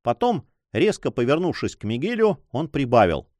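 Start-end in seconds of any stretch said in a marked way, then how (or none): none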